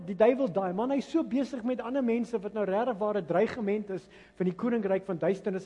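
ordinary delivery

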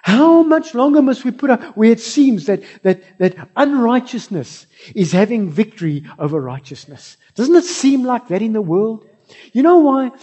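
loud, high fervent preaching; preaching at full force. Acted. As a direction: preach it out to the congregation. Preaching rises.

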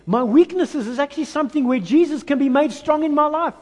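high, loud preaching of Paul's words; fervent. Acted. Preacher voices Paul's new outlook to the church, fervent. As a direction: proclaim it with rising fire.

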